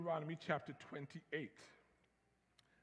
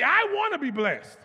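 higher, much louder proclamation; 8 semitones higher, 13 dB louder than usual. Shown as a demonstration. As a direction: shout it like you really want it. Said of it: "if you really want to be blessed".

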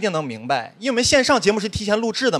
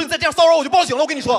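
fast, high, fed-up threat; mock exasperated warning; 7 semitones higher, faster than usual. Son warning his pestering father, exasperated. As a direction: rattle off a comic ultimatum.

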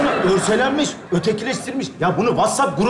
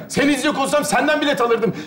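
ordinary talk, nothing marked

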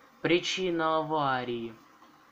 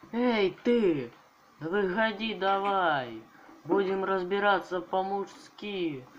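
shouting challenge; 5 semitones higher than usual. Acted. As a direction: yell at him to come out. Calling enemy out to fight.

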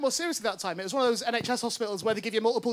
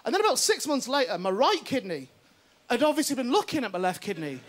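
ordinary talk

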